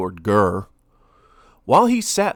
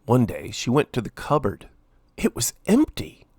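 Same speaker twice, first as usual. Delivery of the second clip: ordinary delivery, nothing marked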